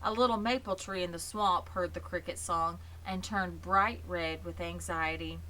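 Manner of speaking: ordinary delivery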